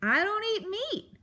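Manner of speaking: high, emphatic outburst; bellowing. A narrator's reading. Porcupine correcting the mouse to her face, bellowing. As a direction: bellow it in one exasperated burst. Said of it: "Aerith bellowed into Poppy's face".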